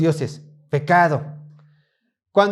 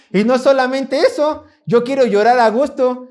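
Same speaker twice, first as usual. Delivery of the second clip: upset outburst; 5 semitones higher, 5 dB louder than usual. Acted.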